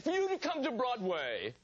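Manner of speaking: "Broadway" with the weight on "way", not "broad"; stress on way. Retold or imitated. Older actor on the phone, stressing the way in Broadway. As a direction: lean on "way" in "Broadway". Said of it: "He said".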